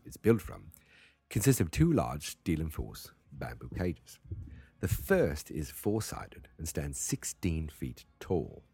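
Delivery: deliberate, put-on British reading; British accent. Shown as a demonstration. Host retries the Wikipedia rules, British accent. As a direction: read slowly in an amateur British accent. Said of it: "gonna do it one more time".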